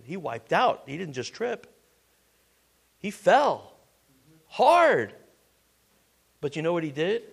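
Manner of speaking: ordinary talk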